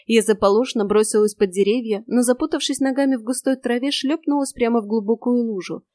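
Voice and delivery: ordinary delivery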